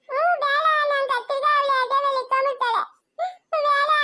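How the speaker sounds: put-on high, whiny child's refusal; crying. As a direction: refuse through tears. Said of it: "ರಾತ್ರಿಯಿಂದ ಒಂದೇ ಸಮನೆ ಅಳ್ತಾ ಇದ್ಯಾ".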